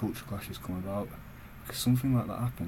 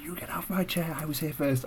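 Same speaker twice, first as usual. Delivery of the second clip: higher, pushy imitation of the man; mock angry passenger; 7 semitones higher than usual. Imitating the passenger telling him to get off, mock indignant. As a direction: mimic him demanding the seat.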